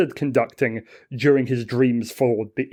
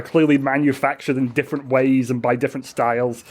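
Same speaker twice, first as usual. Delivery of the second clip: ordinary delivery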